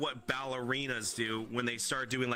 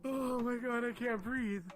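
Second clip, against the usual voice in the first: high, winded whine; real, spent high wail; breathless from laughing; 6 semitones higher than usual. Real, not acted. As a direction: struggle to talk after hard laughter. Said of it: "I literally cannot breathe right now".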